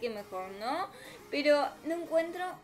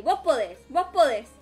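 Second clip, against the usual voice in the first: louder pump-up; self pep talk; 7 dB louder than usual. Real, not acted. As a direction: psych yourself up. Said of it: "¿Cómo lo ensayaste, [name]?".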